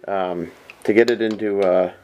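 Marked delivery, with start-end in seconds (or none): none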